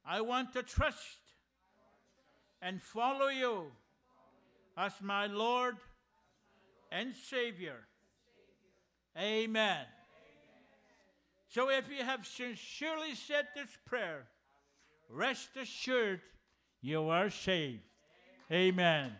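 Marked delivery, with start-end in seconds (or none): 0.0-9.8 solemn sinner's prayer led aloud; leading prayer. Acted. 15.1-19.1 reassuring. Acted. Pastor assures the saved.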